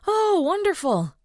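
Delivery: high, slow gush of delight; gushing excitedly. Acted. Audrey to the dog's owner, gushing with high excitement. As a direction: burst out with delighted relief. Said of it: "Audrey gushed excitedly".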